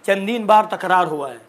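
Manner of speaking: ordinary talk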